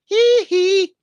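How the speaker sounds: high, loud falsetto "hee hee"; piercing pop-star yelp. Retold or imitated. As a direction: squeal it high and drawn out. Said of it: "that, uh, [name]".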